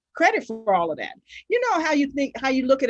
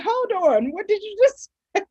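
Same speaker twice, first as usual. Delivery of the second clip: surprised; 9 semitones higher than usual. Retold or imitated. Retold surprised quote, laughing.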